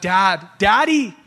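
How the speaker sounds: desperate cry of a believer to God. Retold or imitated. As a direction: plead like a child needing his daddy. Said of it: "We cry out".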